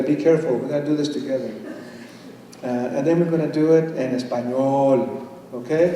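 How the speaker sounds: normal talking voice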